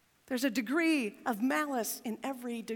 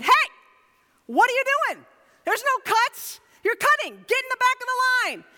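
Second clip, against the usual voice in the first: much higher, louder call at line-cutters; retold shout; 11 semitones higher, 8 dB louder than usual. Pastor retelling yelling at line-cutters, loud and high. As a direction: bark at the couple who cut in. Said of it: "before I could even think".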